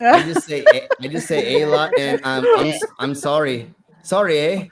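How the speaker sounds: high, laughing overplayed sorry eh; a squeaky, giggling put-on Canadian; laughing mock Canadian. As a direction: giggle through a fake Canadian apology.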